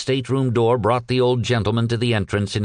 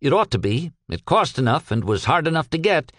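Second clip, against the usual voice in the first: higher-pitched, emphatic character voice; earnest, weary Peck; 3 semitones higher than usual. Acted. Peck reporting to his boss about the vase, earnest and emphatic. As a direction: give an aggrieved, dutiful account.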